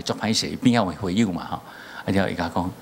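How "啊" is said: usual speaking voice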